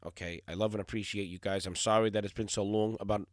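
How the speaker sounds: ordinary talk, nothing marked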